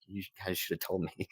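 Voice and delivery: soft complaint broken by a laugh; mock reproach, laughing through the words. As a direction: quietly laugh it out as you complain. Laughing over his own scare.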